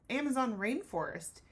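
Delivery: ordinary delivery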